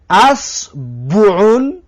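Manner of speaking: اسبعون slowly as asbuun with u on the b; second variant. Shown as a demonstration. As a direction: stretch out اسبعون, open a then u. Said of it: "بگو اس".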